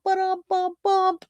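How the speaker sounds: high mouth rimshot, ba-dum bump; a playful drum sting after the pun; rimshot noise. Pretend. Host punctuates her leg joke with a high rimshot.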